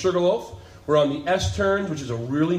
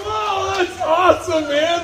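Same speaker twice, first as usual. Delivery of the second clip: much higher, louder, slow amazed cry; amazed exclaim; 5 dB louder, slower than usual. Retold or imitated. Marcus answering the stranger who prays for them, amazed and loud. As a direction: let the wonder burst out loud.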